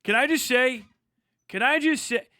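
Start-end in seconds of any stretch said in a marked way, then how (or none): none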